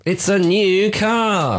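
drawn out, high showman reveal; game show host. Pretend. Echoing the joke, announcing a prize car like a game show host.

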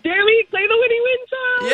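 very high, thrilled call-out; excited cheer. Real, not acted. Caller cues her winning song.

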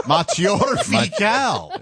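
hammed-up French accent, high and slow; mock the French, drawn out and laughing; Inspector Clouseau. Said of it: "you sound like Inspector Clouseau".

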